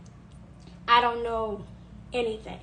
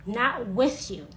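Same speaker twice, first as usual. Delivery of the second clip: ordinary talk, nothing marked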